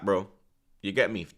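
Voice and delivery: normal talking voice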